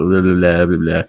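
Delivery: ordinary talk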